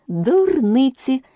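slow, high firm dismissal; a loud, decisive retort. A narrator's reading. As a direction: shut the nonsense down firmly. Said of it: "сказала Аліса голосно і рішуче".

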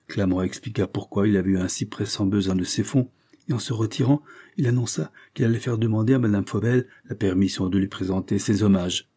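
usual speaking voice

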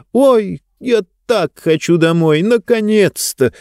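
high, joyful exclaim; excited exclaim. Acted. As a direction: burst out, happy and high. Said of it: "воскликнула она".

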